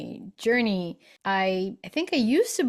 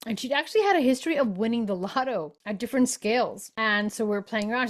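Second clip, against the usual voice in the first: normal talking voice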